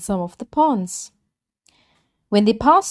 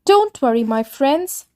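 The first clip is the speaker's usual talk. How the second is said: confident tortoise; 6 semitones higher than usual. Acted. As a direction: reassure them as the cocky tortoise.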